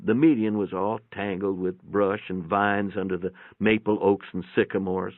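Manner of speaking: usual speaking voice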